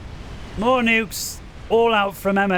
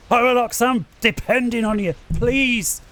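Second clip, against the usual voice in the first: higher-pitched, excited begging; excited pleading; 4 semitones higher than usual. Real, not acted. Commentator depending on his player to win, excited pleading. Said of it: "you can hear me excitement there".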